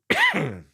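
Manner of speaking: sharp cough. Real, not acted. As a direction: cough once, hard. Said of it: "sorry about the coughing".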